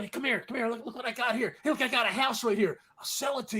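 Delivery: hushed, sneaky sales pitch; shady trunk seller. Pretend. A hustler hushing a buyer toward a trunk sale, sneaky and quiet. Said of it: "a guy with a trunk going".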